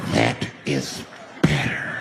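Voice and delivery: very low, slow, drawn-out approval; creepy deep murmur of satisfaction; satisfied madman. Acted. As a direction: savour each word, deep and slow. Madman savours the quiet bus.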